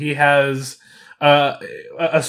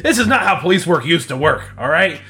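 low, mumbling, fumbling officer; a deep-voiced flustered cop; bumbling cop; 5 semitones lower than usual.

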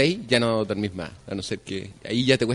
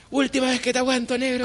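high shouted last warning; a neighbor yells a last warning from below; mock angry yell; 9 semitones higher than usual. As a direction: yell a final warning at him.

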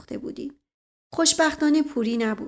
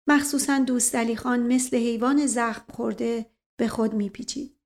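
usual speaking voice

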